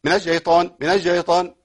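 the ش of الشيطان hissed and spread wide; spread shin. Shown as a demonstration. Teacher showing students the spread shin. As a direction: let the ش in الشيطان spread through the mouth. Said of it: "انتشار الصوت في الفم لحرف الشين".

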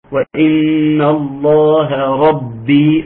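وإن الله ربي chanted slowly, ba in ربي doubled. Shown as a demonstration. Teacher reciting to show the doubled ba, slow chant. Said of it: "مشددة في ربي".

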